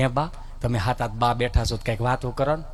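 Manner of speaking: low, soft first try to grandma; mumbled first ask. Retold or imitated. Asking grandma for stories, first low and soft. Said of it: "મેં કીધું".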